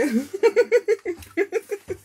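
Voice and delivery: high, loud peals of laughter; loud giggling fit. Real, not acted. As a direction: burst into a long, high giggle. Bursts out laughing at the guides.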